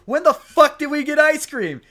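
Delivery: high, loud, slowed blurt of disbelief; incredulous outburst. Retold or imitated. Teammate blurting out at the fiance's ice cream lie, loud disbelief. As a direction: yell it like you honestly can't believe the lie. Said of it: "And it's so funny".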